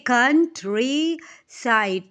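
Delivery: कन्ट्रीसाइड broken as कन् - ट्री - साइड; loud, slow three beats on कन्ट्रीसाइड; syllable by syllable. Shown as a demonstration. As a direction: stretch and separate each syllable of कन्ट्रीसाइड. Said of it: "इसको आपने कितने दफ़ा पढ़ा".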